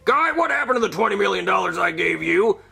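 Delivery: a hint of a boss yelling on the phone; angry boss voice. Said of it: "my boss from nasa".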